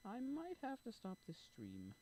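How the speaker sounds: high sing-song, stretched out. Real, not acted. Streamer warning viewers of stopping the stream, sing-song. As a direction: draw it out high, sliding down. Playful first take, repeated plainly.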